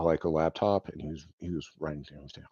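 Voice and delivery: normal talking voice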